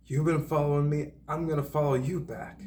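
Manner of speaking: high-pitched mimicry of an accuser; threatening stranger. Woman threatens to follow the man back, high-pitched mimicry. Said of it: "when somebody's like".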